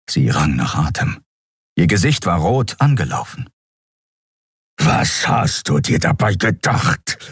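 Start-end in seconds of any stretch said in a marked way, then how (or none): 4.8-7.2 slow, rasped angry demand; menacing slow snarl; growling. Acted. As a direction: growl it out word by word. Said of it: "knurrte Keru".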